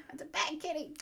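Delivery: Cartman impression. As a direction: scold the cat like a whiny cartoon kid. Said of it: "quoting Cartman".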